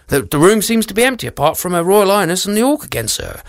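high, fast nervous guard. Acted. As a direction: hurry the report nervously.

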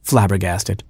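ordinary delivery, nothing marked

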